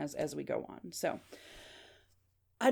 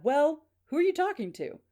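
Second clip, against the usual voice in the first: high, raised-voice dad in the background; dad butting in; 9 semitones higher, 4 dB louder than usual. Acted. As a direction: act out a father calling out a question.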